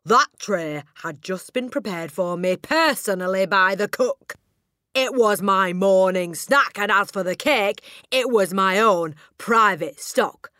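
slow, very loud, outraged accusation; shouting tirade. A narrator's reading. Headmistress accusing the boy of stealing her cake, shouting. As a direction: thunder it out word by word, high and indignant. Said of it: "the Trunchbull shouted".

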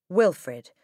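plain reading voice

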